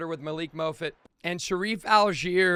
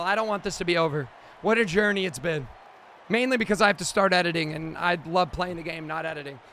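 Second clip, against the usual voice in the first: usual speaking voice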